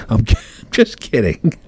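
laughing through the words; giggling at own joke. Real, not acted.

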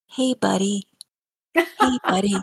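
put-on high, coaxing child voice; little boy's sweet wheedle. Grandmother imitating grandson coaxing his sleepy dad, high wheedling child voice. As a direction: sweet-talk like a small boy after something.

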